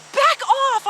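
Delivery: high, loud frightened shout; scared yell. Retold or imitated. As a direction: scream it at him in panic. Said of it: "I just got so scared".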